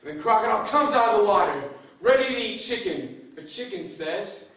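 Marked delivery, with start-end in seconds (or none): none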